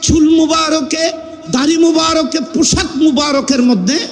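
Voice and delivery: high, chanted sermon in measured phrases; melodic preaching. Acted. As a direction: hold each phrase on a high reciting note. Preacher intones the dream.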